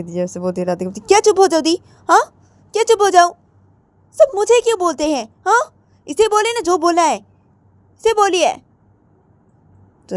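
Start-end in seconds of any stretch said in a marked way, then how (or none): 1.0-8.5 much higher, loud, stretched final word; why does everyone scold me; indignant protest. Acted. Radhika protesting to her sister, high and indignant.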